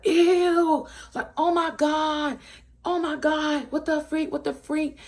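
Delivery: high-pitched, drawn-out disgust; mock-horrified high squeal; grossed-out reaction. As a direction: imitate a roomful of friends squealing in disgust.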